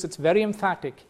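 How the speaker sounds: usual speaking voice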